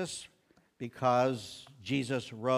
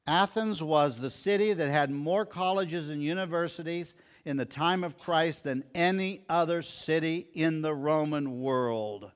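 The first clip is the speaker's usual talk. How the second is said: ordinary talk, nothing marked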